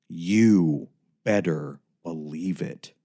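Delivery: slow, flat Brando delivery; deadpan. A narrator's reading. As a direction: drawl it out like tough-guy Brando. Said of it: "I turned to him and deadpanned".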